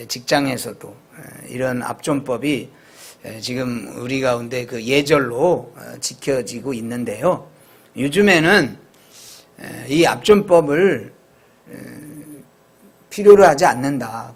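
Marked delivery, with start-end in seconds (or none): none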